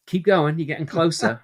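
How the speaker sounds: high, loud voice of a sister coaching; imitated encouraging sister. Sister on the phone judging his Welsh attempt, imitated encouragement.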